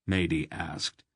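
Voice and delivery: plain reading voice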